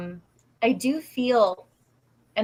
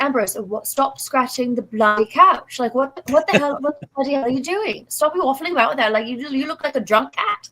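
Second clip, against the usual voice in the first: exasperated, higher British scolding; British accent; 5 semitones higher, 4 dB louder than usual. Acted. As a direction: tell off the cat in an English accent. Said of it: "I start talking like this".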